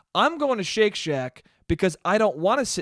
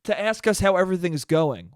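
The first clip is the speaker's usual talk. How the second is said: usual speaking voice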